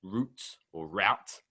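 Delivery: first "route" as root, second "route" rhyming with out; two pronunciations contrasted. Shown as a demonstration. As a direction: slowly offer "route" as "root", then as "rowt". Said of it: "which one's American and what's English".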